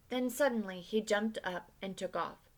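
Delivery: ordinary delivery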